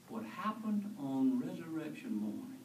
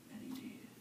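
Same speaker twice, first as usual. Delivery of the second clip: breathy hush; whispered aside; 9 dB quieter than usual. Real, not acted. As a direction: let it fall to a whisper. Quiet aside after the order.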